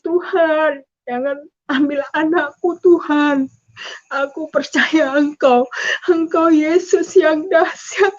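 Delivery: slow, pleading retold prayer; tearful prayer. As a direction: beg tearfully, stretching the words. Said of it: "Saya menangis".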